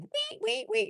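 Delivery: very high squeaky wee wee; baby guinea pig cries. Retold or imitated. Imitating the baby guinea pigs crying for their mama, very high squeaks. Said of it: "When they starts crying".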